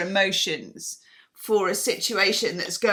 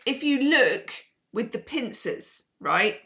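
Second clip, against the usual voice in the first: normal talking voice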